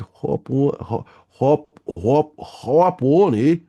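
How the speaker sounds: mock Canadian "hop on, eh"; hammed-up Canadian drawl, slow; Canadian accent. Streamer urging viewers, in a mock Canadian accent. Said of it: "I all of a sudden have a Canadian accent".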